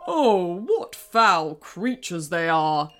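low, slow spat insult; spitting disgust. Acted. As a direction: spit the words out with contempt. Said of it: "spat the king".

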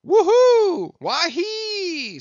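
very high, loud whooping yell; piercing high whoop; screamed war whoop. A narrator's reading. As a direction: let loose a wild, drawn-out war cry.

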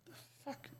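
whispered, baffled aside; a hushed, puzzled mutter from the crowd; bewildered whisper. Retold or imitated. Mimicking baffled arena fans, whispered.

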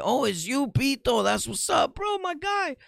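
high, hammed-up excited greeting; sarcastic, squealing overjoyed hello; mock delighted recognition. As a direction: pretend to be thrilled to recognise an old buddy. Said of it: "These people think you're supposed to guess that".

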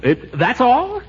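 high, disbelieving question; amazed it's nothing worse; incredulous relief. Acted.

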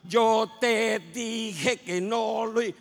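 mock stutter, very slow, loud, syllable by syllable; angry mother stammering 'Yo te dije' out slowly. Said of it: "mi mamá se puso tartamuda". (madre furiosa que tartamudea por sílabas, muy lento y fuerte)

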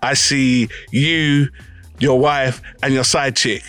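usual speaking voice

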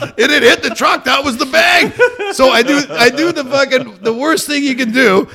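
high, loud agitated retelling; excited, loud. Real, not acted. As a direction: tell it worked up and loud.

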